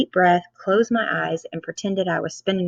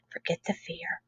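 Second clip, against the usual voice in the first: whispered mantra; 13 dB quieter than usual. Acted.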